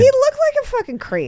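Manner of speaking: disgusted squeal. Real, not acted. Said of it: "reacting to his photo".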